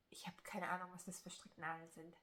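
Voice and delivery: whispered, half to herself, a little embarrassed; sheepish whisper. Real, not acted. Crafter admitting to viewers she doesn't know her needles, whispering. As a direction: drop to a hushed confession.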